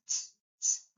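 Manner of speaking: whispered ts-, ts- hiss; ts sound. Shown as a demonstration. Teacher isolating the ts sound of German z. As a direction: hiss a sharp ts twice. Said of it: "so T S".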